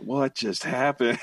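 slow, dazed, wide-eyed; stunned disbelief. Retold or imitated. Sitting stunned in the passenger seat, slow dazed disbelief. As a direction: let it hang in shock. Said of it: "with my eyes wide".

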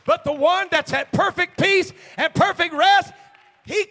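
high, sustained shouted preaching; full-force preaching. Real, not acted. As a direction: thunder out assurance over the crowd.

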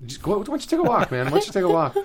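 imitating a boss calming a worker. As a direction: talk him down gently. Boss calming him down, retold.

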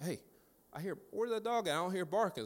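high-pitched mock puzzlement; lightly acted confusion; puzzled. Puzzled reaction in retold story.

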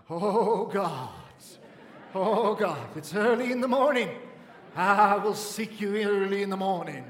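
mock pious prayer voice. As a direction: lampoon a showy churchy prayer. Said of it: "gotta change your accent before God".

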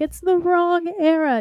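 high, slow fussy correction; pedantic complaint. Retold or imitated. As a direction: point out the mistake primly. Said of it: "and it's like".

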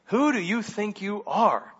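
sneering, who-are-you-to-talk question. Acted. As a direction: put them down with a sneer. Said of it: "This is a sneer".

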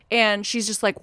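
ordinary delivery

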